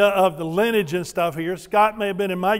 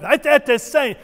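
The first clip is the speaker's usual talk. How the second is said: higher, punchy Ete, ete sane; gruff Twi greeting; 6 semitones higher, 3 dB louder, slower than usual. Shown as a demonstration. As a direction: sound almost mad at them. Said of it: "almost sounds gruff".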